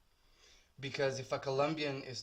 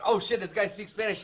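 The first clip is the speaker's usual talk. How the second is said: higher, louder mock astonishment; surprised discovery; 6 semitones higher, 6 dB louder than usual. Tattooist imitating clients hearing his Spanish, mock surprise. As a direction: imitate people excitedly finding out. Clients' reaction acted out in retelling.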